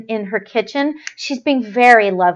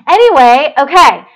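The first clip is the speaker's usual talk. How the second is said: higher and louder, triumphant relief; relieved excitement; 7 semitones higher, 8 dB louder than usual. Real, not acted. Host cheering to viewers that the glitchy show is over, excited. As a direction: burst out happy it's over. Relief after stream troubles.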